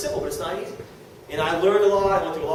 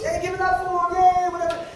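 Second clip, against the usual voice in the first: light higher-pitched breezy emcee voice, offhand; breezy president; 12 semitones higher than usual. Retold or imitated. President to stage crowd, a lightly hammed-up breezy hype. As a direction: toss it off like a hammed-up host. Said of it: "Dennis Windsor, goes".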